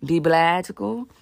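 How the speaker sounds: slow, loud Be magical rising on "Be"; slow catchphrase. Shown as a demonstration. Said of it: "as we like to say it over here".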